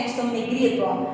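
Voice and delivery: ordinary delivery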